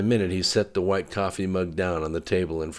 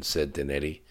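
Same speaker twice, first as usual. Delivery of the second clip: plain reading voice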